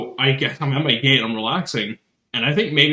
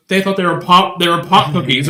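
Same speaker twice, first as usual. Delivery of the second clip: higher and louder, amazed; incredulous retelling; 6 semitones higher, 6 dB louder than usual. Retold or imitated. Friend at airport quoting herself, loud disbelief. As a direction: tell it like you still can't believe it. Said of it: "she goes".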